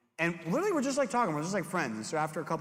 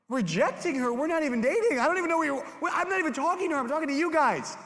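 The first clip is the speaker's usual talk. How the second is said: put-on shrill-pitched, much higher, exasperated kid; high panicked protest; 12 semitones higher, 5 dB louder, faster than usual. Youth pastor replaying his sixth-grade self's high panic. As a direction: protest in a high squeak, flustered.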